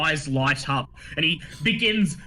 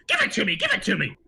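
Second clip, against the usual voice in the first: lightly voiced gnome wife; 8 semitones higher than usual. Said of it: "she says".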